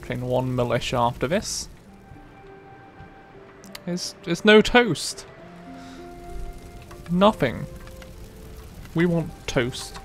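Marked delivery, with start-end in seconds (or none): none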